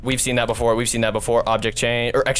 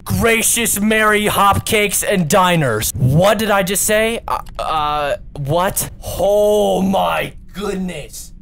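stunned disbelief; 5 semitones higher, 4 dB louder, slower than usual. Real, not acted. Dismay after losing the game.

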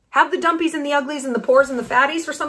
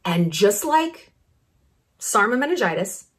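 ordinary delivery

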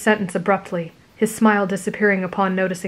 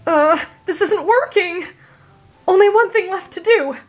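panicked; 11 semitones higher, 5 dB louder than usual. A narrator's reading. Midoriya panicking.

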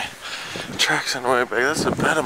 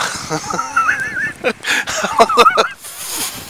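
real laugh. Real, not acted. Stunned by deep snow.

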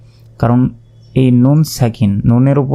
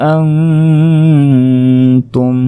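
very slow chanted phrase, long nasal hum; slow melodic tilawat; Quran recitation with ghunnah; 3 semitones higher, much slower than usual. Shown as a demonstration. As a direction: recite ওয়ালা আনতুম with drawn ghunnah. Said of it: "গুন্না করে পড়তে হবে".

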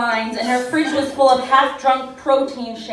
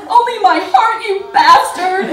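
much higher, louder, slowed scream; 9 semitones higher, 9 dB louder than usual. Acted. Roxy screaming at cheating boyfriend on phone, loud shriek. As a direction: shriek the insult at him. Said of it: "Roxy screamed into the phone".

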